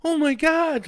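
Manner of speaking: high, loud cry of terror. A narrator's reading. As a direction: cry out in sudden fear. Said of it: "Leah cries out".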